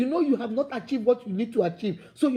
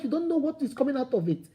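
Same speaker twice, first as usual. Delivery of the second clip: ordinary talk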